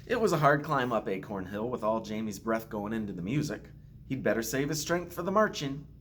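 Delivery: usual speaking voice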